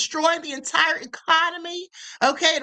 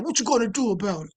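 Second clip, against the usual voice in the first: mock Italian tough guy; 6 semitones lower than usual. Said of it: "Cuomo sounded like a typical Italian".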